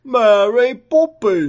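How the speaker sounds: high, slow, loud fake Cockney; mock Cockney accent. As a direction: stretch it out in bad Cockney. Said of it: "his outstanding Cockney accent".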